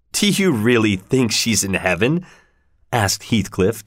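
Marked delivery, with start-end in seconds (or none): none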